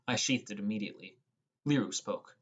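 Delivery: plain reading voice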